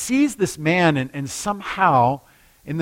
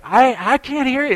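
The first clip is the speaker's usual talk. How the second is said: put-on much higher, strained old man voice; high, strained deaf old man; 11 semitones higher, 4 dB louder than usual. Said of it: "he's like".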